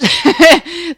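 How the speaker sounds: loud, high mock cackle; gleeful pretend witch laugh. In the witch pose.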